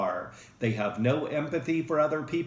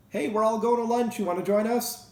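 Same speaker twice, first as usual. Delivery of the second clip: bright, higher invitation to lunch; friendly coworker; 9 semitones higher than usual. Retold or imitated. Coworker inviting colleague to lunch, higher cheery voice. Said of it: "you say to a schizoid coworker".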